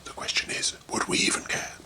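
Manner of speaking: unvoiced whisper; whispered aside. Real, not acted. Reader whispering a mocking jab at the hero. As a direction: whisper it like a sarcastic secret. Snarky aside about Eric.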